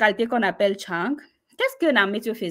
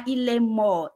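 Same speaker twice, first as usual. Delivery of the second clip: ordinary talk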